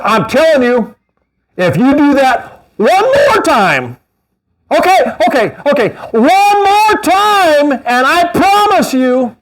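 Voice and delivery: high, slow, wavering mock-parent warning; empty parental threat. Pastor mocking a parent's repeated idle threats, high and slow. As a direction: play the parent who keeps backing down.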